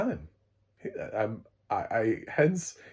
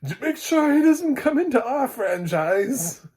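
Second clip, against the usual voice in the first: much higher and louder, slow, spooky; hammy ghostly warning; mock-ominous creature; Dementor voice; 8 dB louder than usual. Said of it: "Dementors from Harry Potter are gonna come in and be like".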